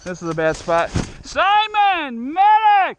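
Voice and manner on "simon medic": man down shout. Real, not acted. Wounded, calling for medic.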